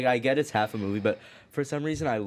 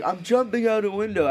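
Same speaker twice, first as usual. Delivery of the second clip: light British voice, higher; mock British accent; 9 semitones higher than usual. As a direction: quote it in a put-on British accent. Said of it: "repeating the accented quote".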